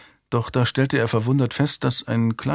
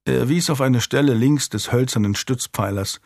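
ordinary delivery, nothing marked

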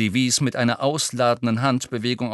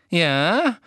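higher, louder eager Umbridge; sudden excitement; 7 semitones higher, 4 dB louder than usual. A narrator's reading. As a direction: pounce on the hint. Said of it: "mit jäh wiedererwachter Erregung". (plötzlich erregt)

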